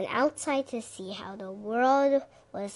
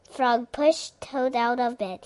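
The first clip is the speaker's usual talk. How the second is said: plain reading voice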